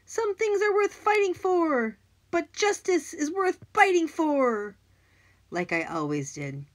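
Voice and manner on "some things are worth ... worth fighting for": high, loud, slow heroic declaration; boy yelling a hero's catchphrase; yelled hero quote. Acted. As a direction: proclaim it like a movie hero. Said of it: "and yelled".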